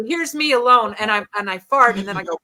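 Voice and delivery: ordinary talk, nothing marked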